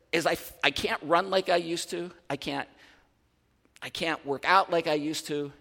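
ordinary talk

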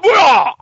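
sudden high loud yell; mock attack yell. Rick cutting Negan's throat, a high loud mock yell. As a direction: burst out with a hammed-up battle cry.

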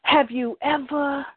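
slow, paced poetry recital with long pauses; spoken word poem. Acted. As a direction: savor each word like a slam poet on stage. S1 recites her love poem.

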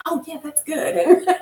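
chuckling through "Oh, yeah, that's good."; amused, laughing agreement; laughing approval. Retold or imitated. Retelling her reaction to the show.

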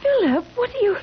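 high, cut-off frightened plea; panicked, breaking off mid-question; alarmed. Acted. Bride pressing her fiancé for answers, alarmed.

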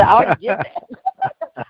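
quoting her through laughter; laughing quote. Retold or imitated. Retelling the elderly lady's text, laughing. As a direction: laugh out her line, slow and high. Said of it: "She said".

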